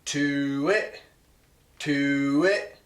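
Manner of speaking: "To it" as short voiced honks; goose honk syllables. Shown as a demonstration. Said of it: "you're gonna say".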